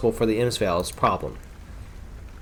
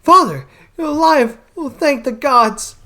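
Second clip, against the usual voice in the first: put-on high, loud, joyful young woman's voice; overjoyed daughter; 12 semitones higher, 5 dB louder than usual. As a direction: cry out in relief at seeing father alive. Daughter greets rescued father.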